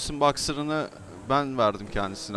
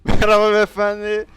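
mock polite greeting; 10 semitones higher, 12 dB louder than usual. Mock-greeting a stranger in game.

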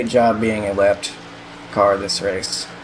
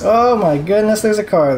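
surprised; 8 semitones higher, 3 dB louder than usual. Real, not acted. Spots stopped car in replay.

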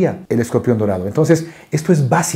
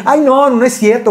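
wife's protest; 8 semitones higher than usual. Retold or imitated. Wife protests in story.

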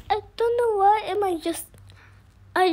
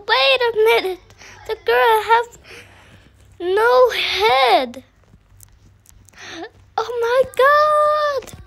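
louder, higher excited exclaiming; loud excitement; 6 semitones higher, 8 dB louder than usual. Real, not acted. Gamer telling viewers the girl has no head, loud and excited. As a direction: call it out in excited disbelief.

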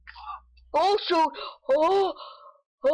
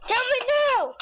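high urgent demand, pitched way up; demanding shout; 11 semitones higher than usual. Shown as a demonstration. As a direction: yell it up high, insistent.

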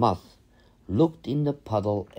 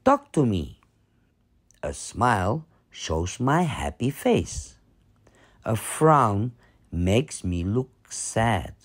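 ordinary delivery, nothing marked

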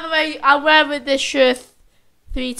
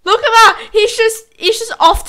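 much higher and louder excited call; 8 semitones higher, 9 dB louder than usual. Real, not acted. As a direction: point it out with a burst of excitement. Pointing at friend in clip.

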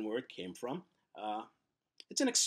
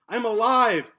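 much higher, much louder cry; desperate shout; 9 semitones higher, 14 dB louder, slower than usual. Acted. Pencil shouting to anyone listening, a desperate high cry. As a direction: yell it to be heard.

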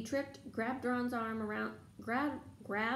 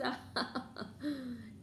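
high wicked cackle; poltergeist cackle; 4 semitones higher, 4 dB quieter than usual. Acted. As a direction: cackle off into distance. Peeves laughing away.

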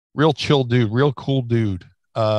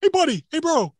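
Bruiser Bedlam imitation. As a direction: ham up a gym bro sizing you up. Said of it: "do your, uh, Bruiser Bedlam imitation".

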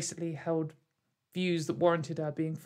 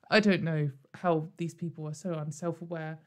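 ordinary talk, nothing marked

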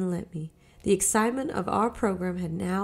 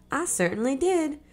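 put-on high, firm indignant boy; indignant insisting; 7 semitones higher than usual. A narrator's reading. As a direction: insist with a scowl. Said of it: "Doodle reiterated, scowling".

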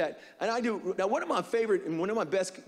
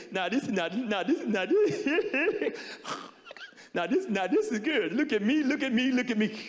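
Eddie Murphy impression; 4 semitones higher than usual. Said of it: "He go".

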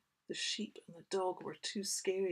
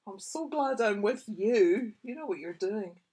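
higher, louder admiring sheep voice; 3 semitones higher, 8 dB louder than usual. Acted. Reader voices sheep, admiring Bess loudly. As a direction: gush with admiration.

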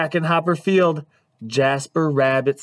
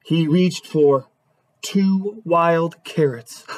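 ordinary delivery, nothing marked

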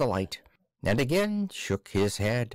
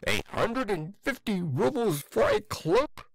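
high, shocked shriek of disbelief; 10 semitones higher than usual. Acted. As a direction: shriek the price in horror. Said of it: "shrieked poor Akaky Akakiyevich".

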